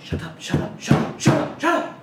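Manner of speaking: high, loud hissed shout; shouting shut up. Acted. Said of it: "Old Horn's like".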